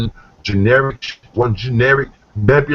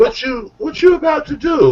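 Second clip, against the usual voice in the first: very high, coy mock-female voice; flirty imitated woman. Woman coming on to the rapper, high coy question. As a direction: pitch way up and play her interest.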